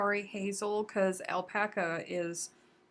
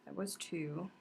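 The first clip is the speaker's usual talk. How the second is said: counting under breath; 4 semitones lower, 8 dB quieter than usual. Real, not acted. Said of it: "let me count this out real quick".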